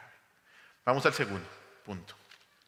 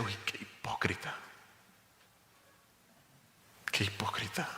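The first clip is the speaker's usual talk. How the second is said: hushed, fading into a self-rebuking whisper; under his breath, scolding himself; muttering to himself; 3 semitones lower, 7 dB quieter than usual. Retold or imitated. Preacher recalling his own anger, muttering quietly to himself. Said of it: "Y yo decía".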